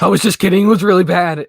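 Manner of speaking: imitating a sister coming clean loudly; sudden mock confession. Retelling sister's sudden admission.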